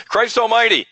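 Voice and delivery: half-joking loud outburst, "almighty" hit hard and high; mock exclamation. Mocking the swearing complaint.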